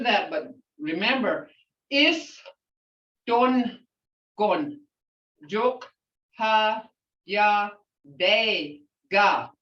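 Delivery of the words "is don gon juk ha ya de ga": is, don, gon, juk, ha ya, de ga, each syllable apart; broken into sounds. Shown as a demonstration. Teacher breaking the greeting into single sounds for learners. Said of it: "That's breaking it down into sounds".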